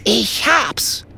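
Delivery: high, eager exclamation; sudden idea. A narrator's reading. Kokosnuss snapping his fingers, excited. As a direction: exclaim it as inspiration strikes.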